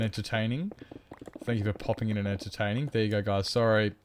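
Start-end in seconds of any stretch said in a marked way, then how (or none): none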